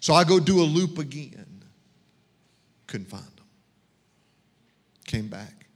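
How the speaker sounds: ordinary talk, nothing marked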